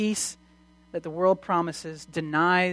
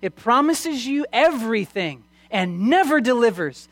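raised, emphatic preacher's warning; impassioned preaching; 4 semitones higher, 4 dB louder than usual. Acted. Preacher warning the congregation about sin's promises, impassioned. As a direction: drive the warning home to the congregation. Preacher warns with full force.

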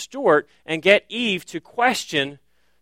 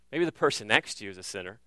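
sly aside; 7 semitones lower, 9 dB quieter, faster than usual. Acted. Aside in serpent's voice.

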